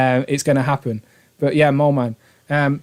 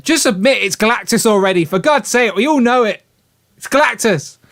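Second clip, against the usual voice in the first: raised-pitch, insistent mock demand; mock exasperated plea; 5 semitones higher than usual. Fan sends Ryan a super chat about Galactus, mock exasperated. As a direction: plead in playful exasperation.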